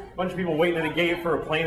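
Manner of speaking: normal talking voice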